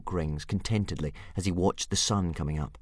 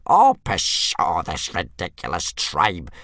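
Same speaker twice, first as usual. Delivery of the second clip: fuming; 8 dB louder, much slower than usual. Acted. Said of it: "fumed Old Wrinkly".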